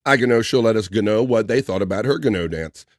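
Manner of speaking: normal talking voice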